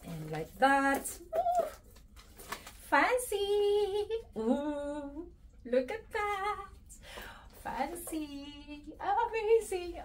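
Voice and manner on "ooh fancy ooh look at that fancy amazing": high, soft fancy voice; playful posh admiration; delighted coo. Real, not acted. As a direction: coo at the strap like a treasure. Playing with the strap.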